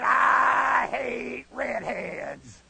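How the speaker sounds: very slow, drawn-out drill instructor menace; Flanagan. Retold or imitated. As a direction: stretch each word out like a sergeant. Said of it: "he talked just like this".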